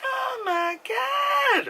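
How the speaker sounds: very high, slow, drawn-out gasp of awe; mock awe. Viewer reading the movie synopsis, a hammed-up awed gasp. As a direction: swoon at the movie's corny synopsis.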